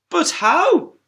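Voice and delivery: high, loud puzzled squeak; puzzled question. Acted. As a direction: ask in bafflement. Character asks, very high.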